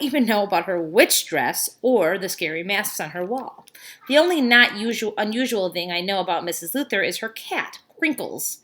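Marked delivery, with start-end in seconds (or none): none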